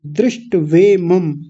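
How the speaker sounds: दृष्टवेमम slowly as DRISHT-VE-MAM; no vowel after the T in दृष्टवेमम; correct half T. Shown as a demonstration. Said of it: "ट भी आधा है". (दृष्टवेमम में आधा 'ट', धीरे-धीरे)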